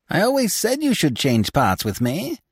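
mocking call, pitched high; cried out. A narrator's reading. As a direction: Harlequin cries out, teasing the fallen Scaramouche. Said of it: "cried Harlequin".

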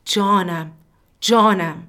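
shouting from the heart. Acted. («جانم» را از ته دل فریاد بزن)

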